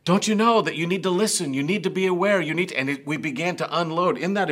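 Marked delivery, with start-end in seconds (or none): 0.1-2.8 higher, fast scolding; strong admonition. Retold or imitated. Parent scolding a child after a near miss, fast scolding. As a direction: scold the child hard and quick. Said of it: "that child received a strong admonition".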